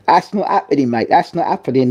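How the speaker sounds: playful mock British accent. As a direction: mimic a friend's put-on British accent. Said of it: "He'd love to do the British accent".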